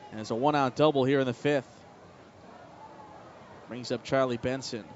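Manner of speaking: excited baseball radio call; play-by-play. Acted. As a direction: call the hit live like a broadcaster.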